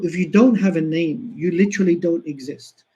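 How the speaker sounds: ordinary delivery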